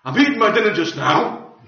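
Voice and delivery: aggressive reply. Retold or imitated. Man interrupted at dinner answering aggressively, curt and irritated. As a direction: push the visitor off while eating. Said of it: "looked at me rather aggressively".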